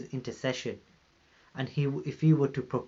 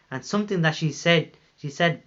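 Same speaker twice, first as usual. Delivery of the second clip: normal talking voice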